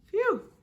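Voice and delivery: relieved exhale; relieved phew. Acted. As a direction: blow out a cheerful phew. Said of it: "cheered Mimble".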